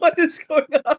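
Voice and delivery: high laughing disbelief. Real, not acted. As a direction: laugh through the question. Laughing at lag.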